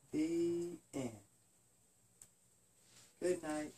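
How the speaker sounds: plain reading voice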